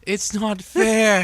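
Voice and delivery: slow, high, drawn-out whine; whiny loud wail. Retold or imitated. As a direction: wail it like a sulking kid. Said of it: "we have you recorded screaming".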